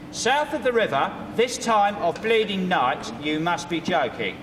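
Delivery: slow, drawn-out Cockney line; Cockney cabbie line. Acted. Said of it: "Your first line is".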